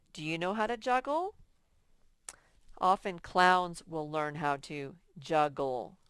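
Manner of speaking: ordinary talk, nothing marked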